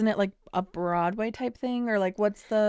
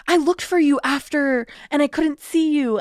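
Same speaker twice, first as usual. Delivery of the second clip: higher, louder stagey reading; overacted line; 6 semitones higher, 5 dB louder than usual. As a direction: overplay the line like a teen movie. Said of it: "she was like".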